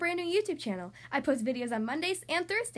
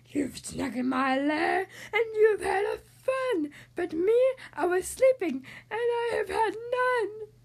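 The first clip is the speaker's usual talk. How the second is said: louder, tearful mock-crying complaint; whimpering bear; 6 semitones higher, 5 dB louder, slower than usual. Bear complaining to the party guests, hammed-up crying. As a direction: blubber it like a grumpy sleepy bear. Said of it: "He whimpers and he moans".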